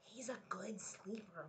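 whispered. A narrator's reading. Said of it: "whispered Papa".

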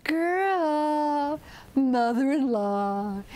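slow, drawn-out singsong call; snake calling up. Acted. Snake calls from the foot of the ladder, drawn-out calling. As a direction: stretch each name out as a call. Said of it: "called up".

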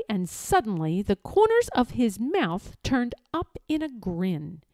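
plain reading voice

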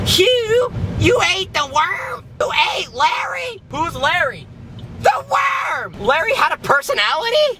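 high, incredulous squawk; mock outrage. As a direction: act appalled that the pet worm got eaten. Acted outrage over eaten worm.

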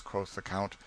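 ordinary delivery

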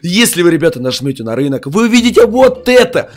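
ordinary delivery

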